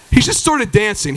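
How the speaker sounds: ordinary delivery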